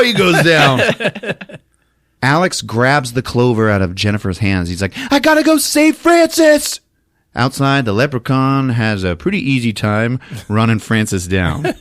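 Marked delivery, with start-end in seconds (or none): 5.1-6.8 high, loud heroic cry; mock urgency. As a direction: announce the rescue high and frantic, acting.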